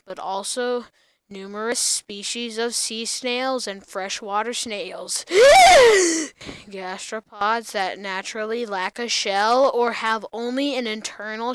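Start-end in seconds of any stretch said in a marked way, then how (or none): 5.3-6.6 loud high-pitched gasp; dramatic gasp. Acted. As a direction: gasp theatrically out of nowhere. Sudden loud high gasp mid-reading.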